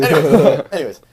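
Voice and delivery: normal talking voice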